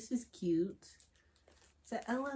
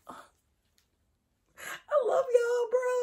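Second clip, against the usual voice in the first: high, loud, drawn-out tearful cry; tearful affection; 7 dB louder, much slower than usual. Real, not acted. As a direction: wail your love, close to tears. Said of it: "I really finna start crying".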